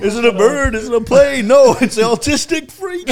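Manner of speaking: mock hype announcement. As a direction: sell it like a cartoon announcer. Mock superhero-style hype intro.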